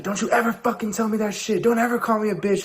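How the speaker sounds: put-on shrill furious girlfriend screaming; furious yell. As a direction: yell it at him after swinging the chair. Girl yelling after chair hit.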